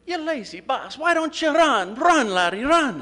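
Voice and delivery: high, loud Scottish scolding that builds into "Run, laddie, run"; angry Scottish yell. Retold or imitated. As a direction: rant like a frustrated Scottish fan. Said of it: "And the Scotsman says".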